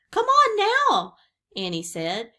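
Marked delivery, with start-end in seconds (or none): 0.1-1.2 high, loud, drawn-out call; shouting. A narrator's reading. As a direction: shout as if from a treehouse window. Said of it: "shouting from the treehouse window".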